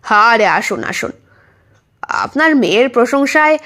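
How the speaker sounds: usual speaking voice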